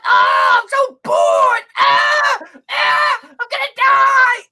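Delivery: loud, slow, groaning complaint; exaggerated bored whining.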